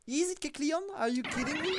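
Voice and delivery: stunned shout of outrage; disbelief. Real, not acted. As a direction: yell at the game in disbelief. Wrong unit appears.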